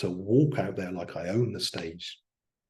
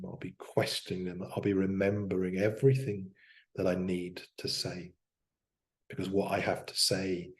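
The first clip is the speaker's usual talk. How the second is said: self-hypnosis voice; 4 dB quieter than usual. Shown as a demonstration. Self-hypnosis demo, eyes closed.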